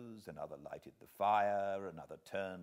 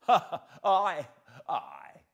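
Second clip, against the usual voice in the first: loud, high hearty ha ha; fat laugh; 9 semitones higher, 13 dB louder, slower than usual. Acted. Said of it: "with a fat sort of laugh".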